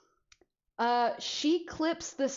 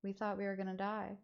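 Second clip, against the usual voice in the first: quiet; 9 dB quieter, faster than usual. Retold or imitated. Relieved friends after mom's reaction.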